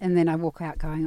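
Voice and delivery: ordinary delivery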